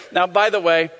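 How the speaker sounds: normal talking voice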